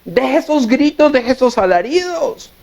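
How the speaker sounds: high-pitched mock scolding, names drawn out; scolding crowd. Bystanders telling the blind beggar to stop shouting, acted high scolding. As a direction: act out an annoyed crowd hushing someone, pitched well up. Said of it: "Los que estaban regañándolo".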